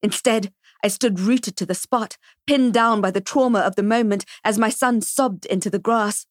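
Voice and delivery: ordinary delivery, nothing marked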